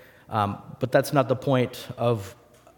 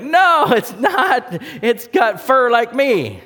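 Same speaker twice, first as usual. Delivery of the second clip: very high, slow, silly sheep voice; naive sheep; 11 semitones higher, 7 dB louder, much slower than usual. Pretend. The foolish sheep argues back to God, dopey and high. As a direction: bleat it out innocently. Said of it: "you're like".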